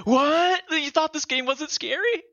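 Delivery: high, teasing voice of the game itself; mock game voice. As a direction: squeal it like the game is taunting you. Mocking the game's voice.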